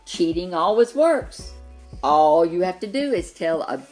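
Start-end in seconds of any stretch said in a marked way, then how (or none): none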